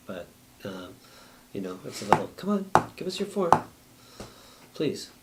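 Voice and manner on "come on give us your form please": high, pleading nudge; coaxing plea. Retold or imitated. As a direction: coax them like a gentle nag. City coaxing residents for forms.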